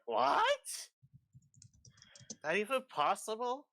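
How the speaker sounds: astonished gasp, rising. Acted. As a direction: voice astonished disbelief. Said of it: "It's like".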